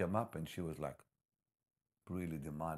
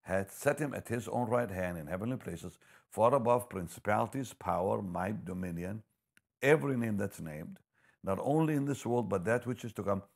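louder and higher, building preacher fervour; fervent scripture reading; 3 semitones higher, 7 dB louder than usual. Acted. Preacher reading Ephesians to the congregation, proclaiming loudly. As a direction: proclaim the verse with mounting force.

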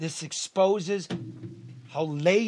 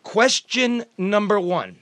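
announcer style; 5 semitones higher, 6 dB louder, slower than usual. Real, not acted. Announcing formally.

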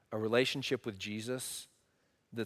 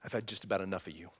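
quiet fed-up threat; 7 semitones lower, 8 dB quieter, faster than usual. Retold or imitated. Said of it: "he's going".

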